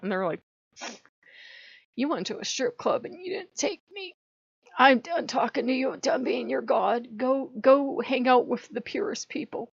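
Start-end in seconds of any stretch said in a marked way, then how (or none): 1.9-9.7 high-pitched wounded huffing; hammed-up hurt and done with you; mock offended. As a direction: act out a jilted sulk, pitched up. Acting out offended gods' complaint.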